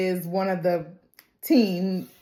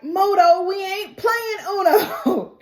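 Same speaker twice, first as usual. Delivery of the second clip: exasperated scolding; 10 semitones higher, slower than usual. Retold or imitated. Teammates scolding Moto.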